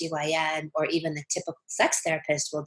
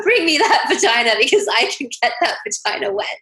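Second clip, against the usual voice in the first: laughing bravado; 9 semitones higher, 6 dB louder than usual. Acted. Laughing boast in an acted reply.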